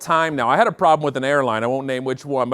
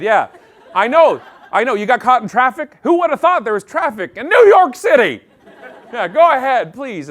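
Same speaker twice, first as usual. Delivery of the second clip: louder, higher, sugary fake courtesy; passive-aggressive politeness; 4 semitones higher, 5 dB louder than usual. To line-skippers at airport security, passive-aggressive politeness. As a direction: smile through clenched politeness, letting everyone cut. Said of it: "stage one Canadian anger, which is passive-aggressive politeness".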